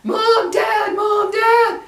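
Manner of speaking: high, loud screamed cry for parents; imitated scream. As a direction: imitate a frightened son screaming for help. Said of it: "we hear him screaming, yelling, screaming".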